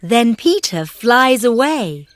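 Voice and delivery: plain reading voice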